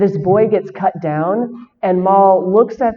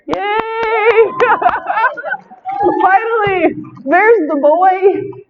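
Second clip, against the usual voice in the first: delighted cheer; 13 semitones higher, much slower than usual. Real, not acted. Fan relief at Maul's return.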